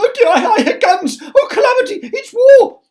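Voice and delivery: very high, loud terrified wail; panicked cry. Acted. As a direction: shriek in mock panic. Panicking over imagined guns.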